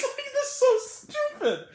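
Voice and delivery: high, slow, through laughter; helpless laughing disbelief. Real, not acted. Critic at an absurd clubhouse raid, high laughing disbelief. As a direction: squeal it slowly while cracking up.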